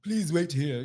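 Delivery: a hint of a formal butler. Butler receiving the hairdresser at the palace, lightly voiced butler. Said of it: "the butler came up to him and went".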